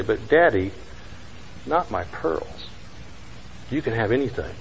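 put-on hushed, begging child's voice; soft pleading girl. Little girl begs her daddy to spare her pearls, softly pleading.

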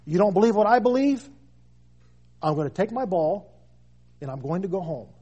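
mock sulky believer.

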